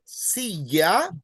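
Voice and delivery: "silla" with a long, stressed first syllable; teacher's demonstration of "silla", first syllable stressed. Shown as a demonstration. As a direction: hit the "si" in "silla". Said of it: "That's why you would say".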